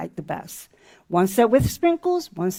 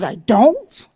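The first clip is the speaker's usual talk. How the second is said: louder, puzzled question; a child unsure he heard right; surprised little boy; 7 dB louder than usual. Retold or imitated. Boy told he has no anger issues, loud puzzled question.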